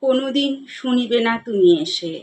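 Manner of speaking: measured poem reading with long pauses between lines; poetry recitation. Acted. Reciter presenting Jibanananda Das's poem Sahaj to listeners, poetry recitation. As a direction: recite with lifted line openings, letting stanza endings sink.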